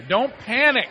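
said loud to the neighbor. Shown as a demonstration. Pastor has the congregation repeat it, loud. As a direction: say it out loud so they hear. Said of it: "say it louder than I didn't even hear you".

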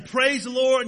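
a lively, hammed-up shout of joy; excited shout. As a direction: jump and clap as you shout it. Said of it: "jumping up and down and clapping her hands and shouting".

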